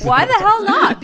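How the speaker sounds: high, loud mock outrage; indignant shout. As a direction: shout it like a rally cry. Mock outrage defending Bernie.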